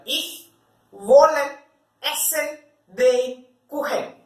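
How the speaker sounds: word-for-word German, slow with pauses; teacher spelling out "Ich wollen essen den Kuchen" slowly, word by word. Shown as a demonstration. Teacher dictating each German word slowly to students. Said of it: "And translate it to German".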